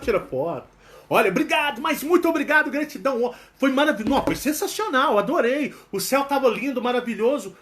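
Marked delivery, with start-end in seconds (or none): 1.1-7.6 high, gushing thank-you; effusive gratitude. Shown as a demonstration. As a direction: overdo the gratitude on purpose. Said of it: "Você tem que interpretar".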